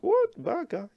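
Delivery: high-pitched mock disbelief, jumping up on "What"; puzzled learner's reaction. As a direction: act out a student startled by the word. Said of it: "go and think".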